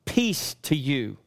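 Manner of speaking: "Peace to you" slowly, with weight on Peace; emphatic repetition. Retold or imitated. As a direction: quote it deliberately, leaning on "Peace" and letting "to you" fall. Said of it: "emphasizing again".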